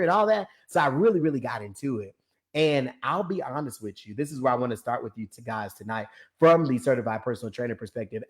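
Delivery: normal talking voice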